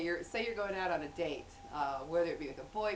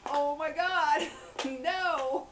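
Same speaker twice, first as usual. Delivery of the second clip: loud, high, drawn-out embarrassed horror; mock horror; 8 semitones higher, 7 dB louder, much slower than usual. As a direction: wail in comic mortification. Retold horror at tucked-in dress.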